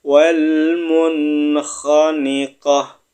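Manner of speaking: slow chanted Arabic; Yu'jibuk recited slowly, final kaf breathed out; Quran recitation. Shown as a demonstration. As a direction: intone it like a tajwid teacher. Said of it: "Kita baca bareng-bareng".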